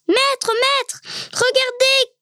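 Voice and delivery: high-pitched, urgent call to the master; excited cry. A narrator's reading. Chichibio alerts his master to the sleeping cranes, excited cry. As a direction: call out eagerly, voice jumping up high. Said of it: "cria-t-il tout de suite".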